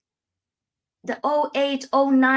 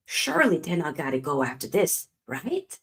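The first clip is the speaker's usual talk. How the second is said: ordinary talk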